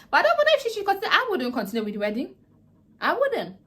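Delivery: high, loud and fast, then settling; anxious outburst. Real, not acted. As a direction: blurt it out in real anxiety. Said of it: "I was so anxious as if it was real life".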